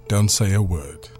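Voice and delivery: ordinary delivery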